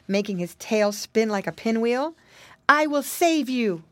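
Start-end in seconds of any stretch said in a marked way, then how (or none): 2.7-3.8 mildly raised, higher call. A narrator's reading. Maiden promising the piggy boys rescue, calling out. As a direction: call it out with light urgency. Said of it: "called the Indian maiden".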